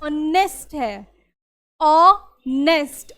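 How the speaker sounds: ऑनेस्ट said slowly, starting on the vowel o, no h; honest with silent h. Shown as a demonstration. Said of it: "क्यों आएगा". (ऑनेस्ट को धीरे से, बिना ह के, ओ से शुरू करके बोलो)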